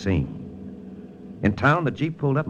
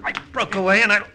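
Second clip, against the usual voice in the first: excited fight narration; 13 semitones higher than usual. A narrator's reading. Detective recounting the alley fight, rising excitement. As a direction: voice climbing higher and higher as the fight heats up.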